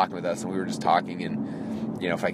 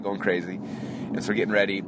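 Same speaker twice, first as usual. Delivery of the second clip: usual speaking voice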